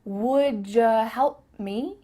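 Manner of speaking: slow "Would ya" as "wood-juh"; slow linked question; ja sound. Shown as a demonstration. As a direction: draw out "Would ya" with a j.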